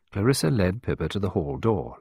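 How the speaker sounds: ordinary delivery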